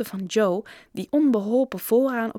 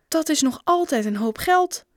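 high, raised-voice exclamation; exclaiming; 8 semitones higher, 5 dB louder than usual. A narrator's reading. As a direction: call it out loud in disbelief. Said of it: "riep Bob". (hoog en luid uitgeroepen)

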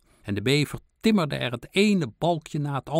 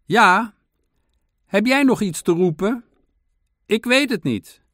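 high, loud shouted exchange; calling back; 11 semitones higher, 8 dB louder than usual. A narrator's reading. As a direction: call back across the fence. Friends still calling over the fence.

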